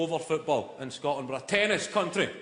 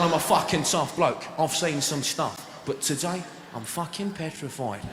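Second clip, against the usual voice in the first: mock Cockney tough bloke confessing; Danny Dyer. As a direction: confess fear with dramatic pauses. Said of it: "He's going".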